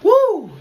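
loud high whoop from the heat. Real, not acted. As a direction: yell out against the burn. Spicy chip challenge.

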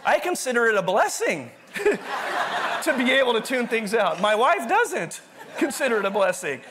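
chuckling at his own habit; laughing. Real, not acted. Laughing about tuning things out.

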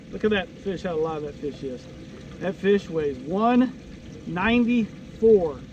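ordinary talk, nothing marked

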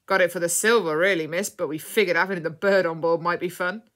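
loud, rough-and-ready pirate talk. A narrator's reading. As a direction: boom it out like a pirate showing off his loot. Pirate answers the captive woman.

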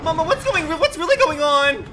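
high, loud disbelieving yell; shocked shout. Real, not acted. Shocked at gameplay.

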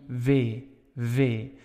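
W said with a light V sound; touch the lip for a V; Dutch V-like W. Shown as a demonstration. Teacher demos the Netherlands W sound. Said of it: "a little bit of a V sound".

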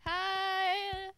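Pearl. Acted. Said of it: "Pearl doing, like, the, uh".

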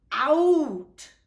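loud sudden high cry; burst out. Acted. Reading to children, the scared dog bolts, loud cry. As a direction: shout it out after a gasp.

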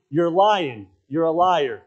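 loud, pointed, serious charge; serious accusation. Acted. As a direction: drive it straight at their face. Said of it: "when you get serious with it".

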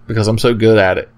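usual speaking voice

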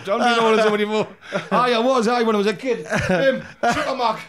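loud laughing answer. Real, not acted. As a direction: laugh through the words.

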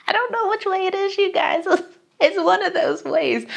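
high, squeaky laughing voice; cracking up. Real, not acted. Vlogger lost on the name's pronunciation, cracking up. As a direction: talk helplessly through your laughter.

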